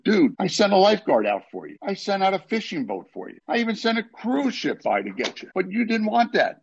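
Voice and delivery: high, exasperated God listing his rescues; a fed-up God laying it out. Acted. God scolds the man who refused every rescue, high and exasperated.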